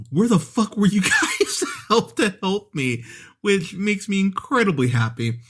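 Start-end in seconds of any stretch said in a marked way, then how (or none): 0.1-3.0 higher-pitched freaked-out quote, breaking into laughter; mock freak-out. Mall Santa calling for help after being jumped, mock freak-out. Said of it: "freaks out and goes".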